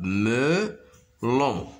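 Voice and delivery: "Melon" in two separated beats; drawn-out, split "Melon" for learners; syllable by syllable. Shown as a demonstration. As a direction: sound out "Melon" as me, pause, lon. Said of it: "se compose de deux syllabes".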